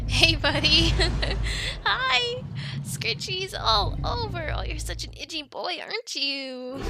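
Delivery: put-on giggly, gushing baby talk to a beloved animal; loud, delighted cooing; doting pet talk. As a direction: fuss over a big itchy pet. Fussing over her dragon.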